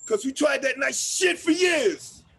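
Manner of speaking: slow, loud, fed-up yell; bitter shout. Acted. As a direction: let years of anger out in one line. Angry monologue about police.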